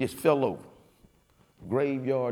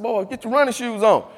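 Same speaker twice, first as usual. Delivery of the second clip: loud, upbeat wake-up call; calling out to sons; 9 semitones higher, 10 dB louder than usual. Retold or imitated. Father calling his boys to go running, loud and brisk. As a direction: rouse the boys out the door.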